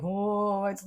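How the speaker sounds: drawn-out spooky "oh"; hammed-up mystical wail; mock spooky. Explaining what Reiki is not, mock spooky.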